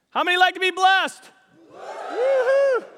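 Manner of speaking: loud, high question then woohoo shout; excited call and whoop. Real, not acted. Preacher leading the church in a woohoo, shouting. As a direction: fire up the church.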